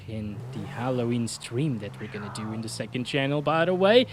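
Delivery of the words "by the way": ordinary talk, nothing marked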